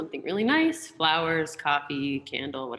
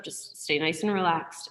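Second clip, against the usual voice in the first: usual speaking voice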